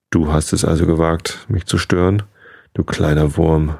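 plain reading voice

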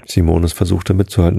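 ordinary delivery

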